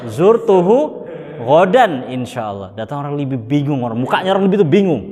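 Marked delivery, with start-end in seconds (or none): 0.1-2.7 slower, drawn-out repeat. Retold or imitated. The Indonesian repeating his mistaken answer slowly. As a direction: stretch the whole sentence out. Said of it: "Datang orang Indonesia".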